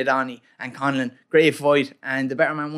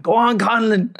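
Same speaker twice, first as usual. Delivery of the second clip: mock Kerry shout; 6 semitones higher, slower than usual. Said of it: "the boys were shouting".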